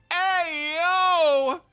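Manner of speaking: high, loud hey yo; excited yell. Real, not acted. Streamer hyped by a big play, loud high yell. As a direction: shout it out, hyped.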